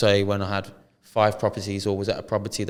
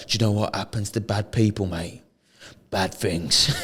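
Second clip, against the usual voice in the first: calm menace. Retold or imitated. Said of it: "In a bit, not aggressive way".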